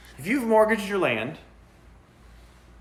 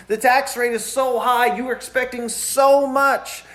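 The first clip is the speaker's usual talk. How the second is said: higher whining voice of a struggling taxpayer; whiny complaint; 6 semitones higher than usual. Retold or imitated. Taxpayers crying to Nehemiah about taxes, higher mock whine. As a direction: moan about taxes like you're barely getting by. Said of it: "They're crying about the taxes".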